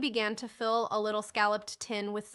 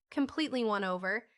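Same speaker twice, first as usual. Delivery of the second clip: plain reading voice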